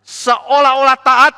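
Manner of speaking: high, loud, slow sneering tone; mock their show of obedience; preacher lampooning false obedience; mock piety. Mocking fake obedience.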